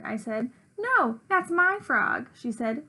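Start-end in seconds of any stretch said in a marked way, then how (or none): 0.8-2.3 high, slow, drawn-out retort; insistent contradiction. A narrator's reading. Narrator character argues back over the frog, insistent. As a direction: stretch the opening word and argue back.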